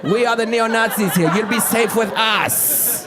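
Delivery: slow, deliberate recruiting voice; slowly and menacingly invite him in; German shepherd gang. Retold or imitated.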